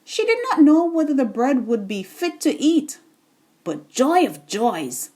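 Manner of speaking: plain reading voice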